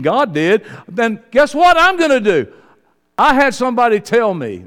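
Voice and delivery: loud, very high shouting sermon; preaching at full force. Acted. Preacher tells the congregation God went before them, shouting and high. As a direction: shout it to the whole church, pleading.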